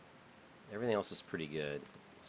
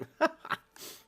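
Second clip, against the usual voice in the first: amused laugh; 5 dB louder than usual. Real, not acted. Laughs at spam trick.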